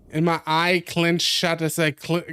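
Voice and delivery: usual speaking voice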